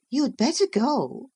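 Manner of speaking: put-on high girl's voice, a coaxing warning; suggesting Catherine. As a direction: pitch it up and coax him away. Catherine urges him.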